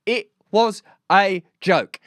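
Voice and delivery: each word set apart slowly; slow, deliberate emphasis. Real, not acted. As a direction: spell it out word by word. Slowly spells out repeated point.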